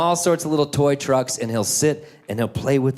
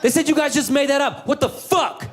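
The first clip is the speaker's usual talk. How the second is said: put-on upset kid; 11 semitones higher than usual. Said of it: "He's like".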